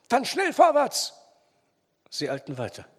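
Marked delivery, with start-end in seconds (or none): 0.1-1.1 high, loud, drawn-out urging; shouted rallying cry; urgent call. A narrator's reading. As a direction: drive them onward. Urging men onward to the victim.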